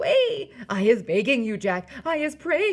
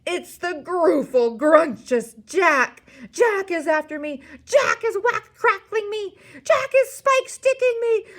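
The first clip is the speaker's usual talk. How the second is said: high, loud, escalating terrified wail; panicked bellowing; 12 semitones higher, 10 dB louder than usual. A narrator's reading. Giant dreaming Jack is attacking him, panicked bellowing. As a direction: bellow each cry higher, frantic with fear. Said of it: "bellowed the flesh lump eater".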